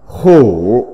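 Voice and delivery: "khổ" said as Mandarin kǔ, low dipping tone; Mandarin pronunciation. Shown as a demonstration. Teacher reads out a character's Chinese reading, "khổ" as Mandarin kǔ. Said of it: "Phiên âm tiếng Trung đọc là".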